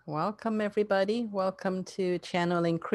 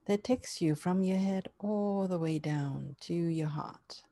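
guided meditation; 3 dB quieter than usual. Acted.

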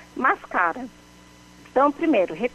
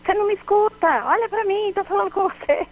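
much higher, accusing reproach; irritated complaint; 9 semitones higher, faster than usual. Shown as a demonstration. Reproaching someone who ignores you, irritated and much higher. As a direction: scold someone who isn't listening. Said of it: "É o tom".

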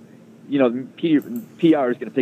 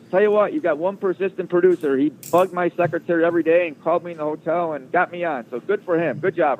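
high mock tycoon praise; lightly voiced boss giving credit; imitating a proud boss; 7 semitones higher than usual. Steinbrenner praising his persistent producer on air, pitched high.

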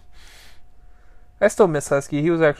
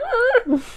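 puppy whimper imitation. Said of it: "he's just like".

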